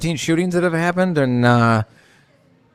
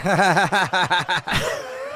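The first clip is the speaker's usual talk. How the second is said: long, higher-pitched cackling laugh; real laugh; 5 semitones higher than usual. Real, not acted. Co-host laughing as the host promises better, amused. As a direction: burst into a sustained run of laughter.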